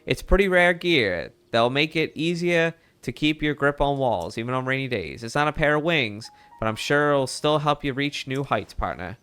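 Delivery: put-on old-timey Mid-Atlantic reporter voice; Mid-Atlantic accent. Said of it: "started going a little southern there".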